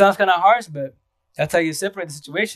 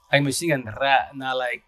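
usual speaking voice

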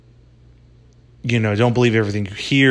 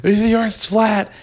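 higher and higher, smug and triumphant; mock flat earther; 8 semitones higher than usual. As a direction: crow it like a vindicated flat earther. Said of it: "You would wanna be like".

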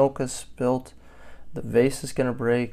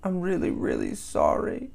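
put-on higher, lightly plaintive child's plea; crying apology; 6 semitones higher than usual. A narrator's reading. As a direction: beg forgiveness like a scolded child. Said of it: "Harriet cried".

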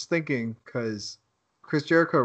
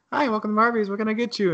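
higher-pitched service greeting; drive-thru employee; 8 semitones higher, faster than usual. Retold or imitated. Employee greeting a customer at Arby's, mimicked higher voice. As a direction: mimic a server's sing-song welcome.

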